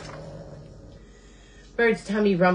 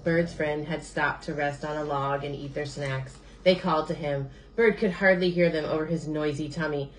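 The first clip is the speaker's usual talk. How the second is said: ordinary delivery, nothing marked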